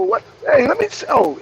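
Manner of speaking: usual speaking voice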